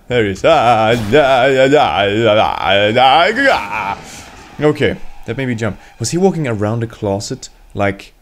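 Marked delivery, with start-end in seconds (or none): 0.4-4.0 loud babbling yell, rising at the end; panicked run of nonsense cries; startled yelling. Real, not acted. Gamer spotting Steve suddenly, startled babbling yell.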